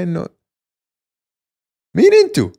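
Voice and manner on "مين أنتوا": shocked high outburst, much higher and louder; incredulous. Retold or imitated. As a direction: blurt it out in disbelief. Mocking incredulous reaction to actress.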